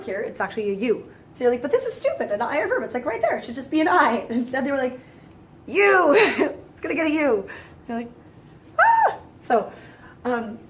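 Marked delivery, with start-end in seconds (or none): 1.6-4.3 high-pitched, exasperated student complaint; mock indignant protest. Student objecting to an illogical verb ending, mock indignation. 5.7-7.5 loud, high, drawn-out announcement with a laugh; mock gleeful decree. Rule-makers imposing a silly letter, mock gleeful decree. 8.8-9.2 very high, loud "ah"; short hammed-up shriek of exasperation; mock frustrated scream. Student reacting to an absurd verb rule, mock scream.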